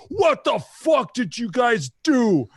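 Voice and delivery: high, drawn-out mock yell; imitated outrage. Brother comes home to the dog's mess, imitated angry yell. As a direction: bellow it slowly as the outraged older brother. Acting out brother finding ruined jacket.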